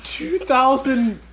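slow, unsure, squeaky high voice; hesitant helium voice. Pretend. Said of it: "Little Ben!".

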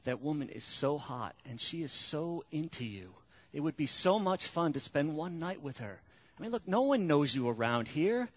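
soft, coaxing tempter voice; seductive tempter. Retold or imitated. Devil tempting a married man, soft and coaxing. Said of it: "It's more like this".

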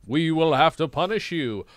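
loud pronouncement from a king; big royal voice; Cat King. A narrator's reading. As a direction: pass judgement loudly as a comic monarch. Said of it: "The Cat King".

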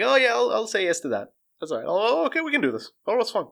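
high, lightly acted acquiescence; breezy agreement. Person agreeing to small things one by one, breezy. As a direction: play someone casually going along. Acts out someone agreeing step by step.

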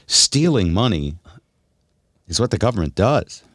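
normal talking voice

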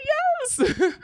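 very high, drawn-out cheerful yes; squeaky yes. Retold or imitated. Answering the counselor, a squeaky high yes. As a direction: pop it up high like a giddy kid. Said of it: "And I was like".

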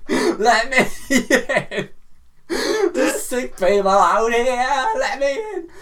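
put-on high, slow pleading through laughter, American accent off; laughing plea. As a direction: beg to be let in while cracking up. Pleading at the hospital door.

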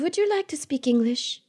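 a faintly haughty offer to switch; mock polite clerk. A friend imitating the bored bookseller. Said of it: "she goes".

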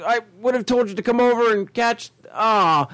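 high-pitched, anguished mock apology; pleading "oh no", hammed-up and high; retold regret. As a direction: re-enact feeling awful for a friend. Retold regret over friend's missed fish.

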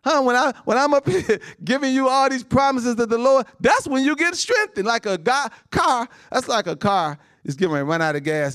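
high, loud preacher's call, laughing through it; fiery preaching. Acted. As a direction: lift the church, preach it out loud. Preacher building up the church.

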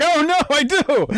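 high, sudden dismayed realization; surprised realization. Retold or imitated. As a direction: blurt it out like you just caught yourself. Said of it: "I was like".

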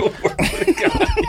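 shrill squealing laugh; high laugh. Real, not acted. Friend keeps cracking up, high squealing laugh. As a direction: cackle high and long.

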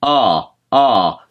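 wide open ah, ah; the good open vowel to copy. Shown as a demonstration. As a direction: drop the jaw and sing ah. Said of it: "You wanna kinda just go".

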